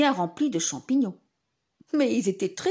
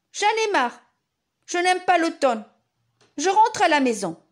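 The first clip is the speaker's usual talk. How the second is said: put-on child voice; 7 semitones higher, 6 dB louder than usual. Paul fed up, child voice.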